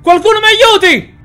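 very high, loud cries for help; man chained in a cellar calling for help; panicked cries. Acted.

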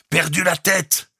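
high, loud, slow declaration; positive, emphatic. A narrator's reading. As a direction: state it emphatically over the storm. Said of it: "continua la voix d'un ton positif". (affirmation criée d'un ton positif)

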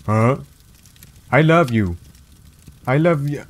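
ordinary talk

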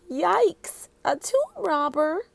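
put-on high little-girl yelp of alarm. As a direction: squeak it out, startled. Annie alarmed by robber idea.

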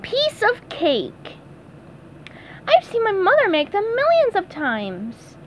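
high, slow squeaky cartoon sponge; cheerful SpongeBob voice. SpongeBob brushes off the worry in a high squeaky voice.

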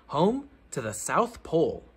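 ordinary delivery, nothing marked

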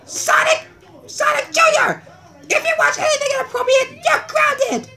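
very high, shrieking parental warning; stern scolding. Acted. Parent threatening Junior with grounding, shrieking. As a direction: call him out sharply, high and rushed.

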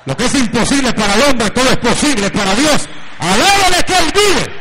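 loud, rising preacher's cry building to praise; preaching at full force. Acted. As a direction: proclaim it with rising fervour. Preaching climax. (predicación a todo pulmón, cada vez más fuerte)

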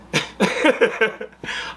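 breathy run of chuckles; laugh. Real, not acted. Amused by the old man's request, chuckling. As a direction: chuckle at your own story.

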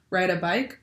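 ordinary delivery, nothing marked

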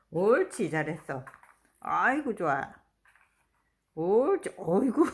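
loud, excited praise for a pet; talking to a dog. Real, not acted. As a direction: cheer the dog on loudly.

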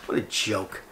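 ordinary talk

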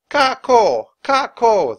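loud high chattering monkey call. Acted. Langurs telling Annie their names, high chatter. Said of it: "the monkeys chattered".

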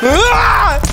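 high, loud scream; sudden high yelp of fright; startled scream. Real, not acted. Startled by a spider.

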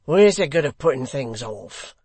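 loud, surly urging. A narrator's reading. Hugh objecting to delay, surly. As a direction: push for action loudly and roughly. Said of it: "in a surly voice".

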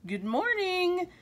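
high, drawn-out sing-out greeting; cheerful greeting. A narrator's reading. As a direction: greet the pets brightly, pitched well up. Cheerful greeting to pets in story.

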